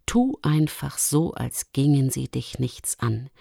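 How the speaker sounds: low, soft, confiding lynx; calm Lumi down quietly and instruct her; soothing Luchsin. Acted. (beruhigende, tiefe, leise Luchsstimme)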